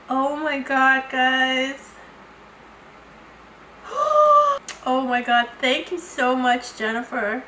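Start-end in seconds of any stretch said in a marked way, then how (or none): 3.9-4.8 long, high, loud gasp; high drawn breath of amazement; astonished gasp. Real, not acted. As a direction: gasp in delighted shock. Sees the next gift.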